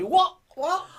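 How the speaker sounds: very high, loud mock Essex "What?"; bad Essex accent. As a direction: ham up a terrible Essex accent. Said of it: "That was a terrible Essex accent".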